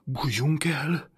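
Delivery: slow, high, trembling plea to hide; a scared boy urging them to hide; shivering fear. A narrator's reading. Frightened boy shivering, begging to hide. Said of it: "mondta dideregve".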